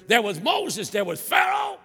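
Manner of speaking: high, loud preacher's climb; imitating the preacher sweeping through the Bible. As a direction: build the sweep to a shouted peak. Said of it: "Can't you just hear him?".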